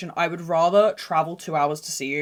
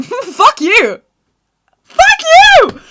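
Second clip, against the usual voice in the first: high, loud laughing shout; playful yell; 14 dB louder, much slower than usual. Acted. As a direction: yell it at the audience.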